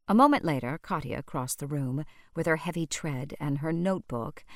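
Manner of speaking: ordinary delivery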